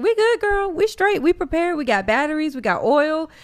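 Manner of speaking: high, breezy reassuring friends; imitating relaxed relatives. Mimicking her North Carolina family, breezy and high. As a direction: toss it off high and carefree. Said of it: "everybody's like".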